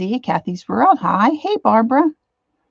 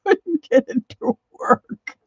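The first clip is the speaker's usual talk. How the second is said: high, laughing through the words; real near-tearful laughing; 6 semitones higher than usual. Real, not acted. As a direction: laugh helplessly, voice jumping up, on the verge of tears. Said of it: "I'm trying not to cry".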